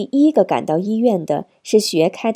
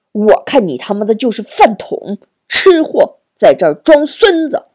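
angry cursing; 2 semitones higher, 7 dB louder than usual. A narrator's reading. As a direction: hurl insults at full temper. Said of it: "佩南骂道". (怒气冲冲地破口大骂)

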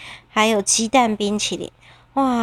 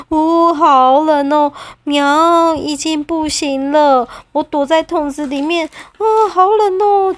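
freezing cat; 5 semitones higher, 4 dB louder than usual. Acted. 猫在冰里冻得受不了.

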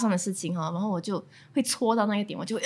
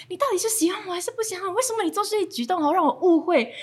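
frustrated inner voice; 7 semitones higher, faster than usual. Retold or imitated. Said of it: "我就想说".

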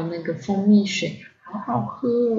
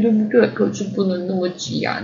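plain reading voice

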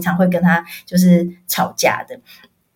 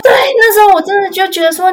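tearful; 12 semitones higher, 5 dB louder than usual. Real, not acted. Recalling pet's death, voice cracking.

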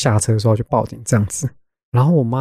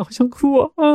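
choked up; 13 semitones higher than usual. Real, not acted. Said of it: "我讲好想哭啊".

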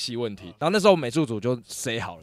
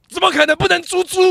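much higher, louder disbelieving rebuke; incredulous scolding; 8 dB louder than usual. Retold or imitated. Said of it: "我回去美术组跟他说".

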